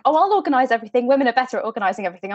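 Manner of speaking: hurried, self-important chatter; fast bossy chatter. Retold or imitated. Mocking Mrs Elton's bossy cutaway line, rattled off fast. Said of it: "she's like".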